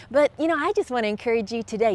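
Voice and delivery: usual speaking voice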